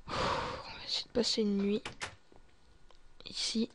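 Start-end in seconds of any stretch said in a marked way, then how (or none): none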